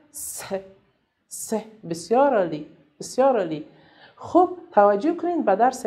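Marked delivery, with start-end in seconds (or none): 0.1-1.5 short hissed "س", the sound alone; sound of the letter. Shown as a demonstration. Teacher showing how a letter is pronounced, short sound of "س". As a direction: say "س" as a brief "se", separately each time.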